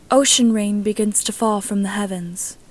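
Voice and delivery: usual speaking voice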